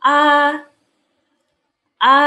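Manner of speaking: A held high and level; tone one demo. Shown as a demonstration. Teacher demonstrating high flat A for students. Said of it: "Seperti ini".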